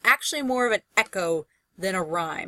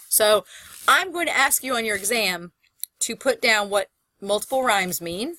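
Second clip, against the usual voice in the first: ordinary delivery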